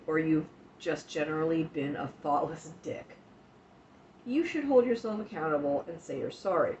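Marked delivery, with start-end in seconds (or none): none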